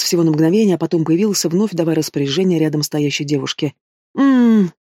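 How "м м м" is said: high, loud groan through closed lips; pained moan. A narrator's reading. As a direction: moan as a flash of pain hits. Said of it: "вырвала стон из моей груди".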